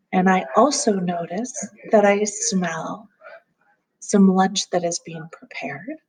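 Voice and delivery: calm grounding exercise. Acted. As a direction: gently guide them through noticing.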